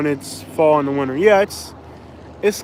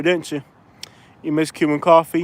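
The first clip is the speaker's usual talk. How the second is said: ordinary talk, nothing marked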